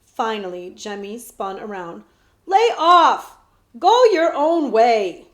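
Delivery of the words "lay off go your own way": loud, high shout to back off; furious boy shouting, slow on the last words; angry shout. Acted. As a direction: yell at him to leave you alone. Jemmy snaps at prince.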